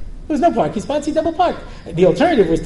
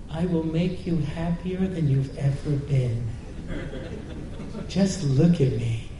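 tempting whisper-soft voice; 4 semitones lower, 8 dB quieter, slower than usual. Acted. Dessert calling out seductively.

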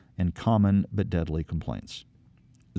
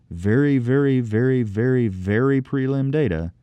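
each very louder and higher; emphatic repetition; 4 semitones higher, 4 dB louder than usual. Real, not acted. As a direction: hammer every very, building. Piling up emphasis on preliminary.

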